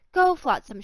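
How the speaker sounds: ordinary delivery, nothing marked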